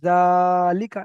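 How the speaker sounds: জালিকা with জা held long and loud, লিকা short; sustained জা on one pitch in জালিকা, no stretch on লি or কা; long ZA, short likā. Shown as a demonstration. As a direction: stretch the first syllable of জালিকা, then release লিকা briefly. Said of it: "এমনভাবে practice করতে হবে".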